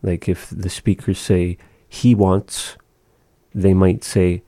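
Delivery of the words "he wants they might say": ordinary talk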